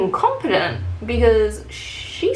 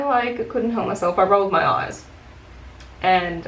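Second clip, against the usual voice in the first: ordinary talk, nothing marked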